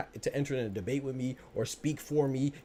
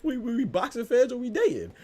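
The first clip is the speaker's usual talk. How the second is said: much higher, louder, in mock disbelief; incredulous mockery; 12 semitones higher, 5 dB louder than usual. Repeating the jab at protective fans, higher incredulous mockery. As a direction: fling the question back, almost squeaking.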